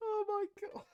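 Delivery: high, soft squeal between laughs; helpless laughter. Real, not acted. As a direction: barely get it out for laughing. Still in laughing fit.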